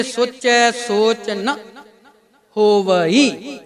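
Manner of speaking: very slow "ਸੋਚੈ ਸੋਚ ਨਾ ਹੋਵਈ", "ਨਾ" set apart and lifted; careful scriptural pronunciation. Shown as a demonstration. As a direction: draw out the final vowel of "ਹੋਵਈ" in full. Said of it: "ਨਾ ਸ਼ਬਦ ਨੂੰ ਚੁੱਕ ਕੇ ਪੜ੍ਹਨਾ". ("ਨਾ" ਨੂੰ ਚੁੱਕ ਕੇ, "ਹੋਵਈ" ਨੂੰ ਲਮਕਾ ਕੇ ਹੌਲੀ ਉਚਾਰਨ)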